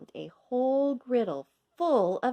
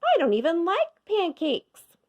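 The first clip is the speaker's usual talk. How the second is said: put-on much higher and louder child voice; second girl; 10 semitones higher, 6 dB louder than usual. A narrator's reading. Girl in line admits it, high and loud. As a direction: chirp it like a little girl. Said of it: "said another girl".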